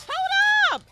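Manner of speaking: shocked outburst; startled hold up. Real, not acted. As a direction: stop everything in surprise. Sudden realization.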